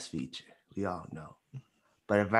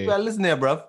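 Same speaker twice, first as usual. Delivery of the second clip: higher-pitched mock British accent; 7 semitones higher, faster than usual. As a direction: do a playful Londoner's British accent. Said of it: "he got a good British accent".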